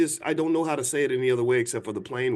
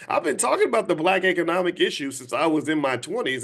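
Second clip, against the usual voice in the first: normal talking voice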